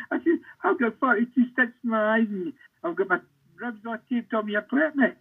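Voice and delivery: high, words breaking into laughter; laughing while talking. Real, not acted. Injured striker told he's playing, laughing through the words. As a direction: laugh your way through the disbelief.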